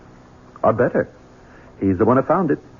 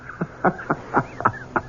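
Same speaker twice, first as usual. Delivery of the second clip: a quiet wicked chuckle under the breath; breathy gloating laugh; 9 semitones higher, 6 dB quieter than usual. Acted. Son gloating to the housekeeper, a soft wicked chuckle. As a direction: laugh softly, savouring the bad news. Gloating over mother's survival.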